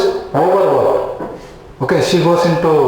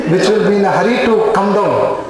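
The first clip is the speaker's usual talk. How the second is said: usual speaking voice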